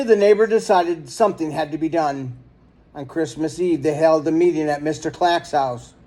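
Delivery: ordinary delivery, nothing marked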